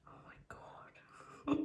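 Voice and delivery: slow stunned whisper. Real, not acted. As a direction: let it out slowly, barely voiced.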